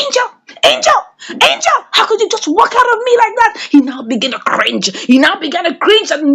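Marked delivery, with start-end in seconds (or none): 0.0-3.7 high mock wail of a wronged man; pleading cry. Kizito crying out to Angel as she leaves, high mock wail. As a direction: cry her name out, much higher, half-acted.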